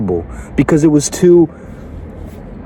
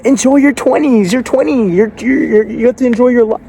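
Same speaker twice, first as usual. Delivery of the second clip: high, sing-song mockery of the advice; mocking high voice; 9 semitones higher than usual. Coach mocking the enjoy-your-twenties advice, high and sarcastic. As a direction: parody the people who say enjoy your twenties.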